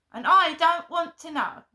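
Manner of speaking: defiant outburst. Real, not acted. Vlogger refusing her mother, loud defiant outburst. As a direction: slam the door on it.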